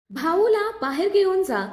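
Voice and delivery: shout. A narrator's reading. Nikhil yells to carry his wounded brother out, shouting. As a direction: yell the order across the fight. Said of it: "Nikhil ओरडला".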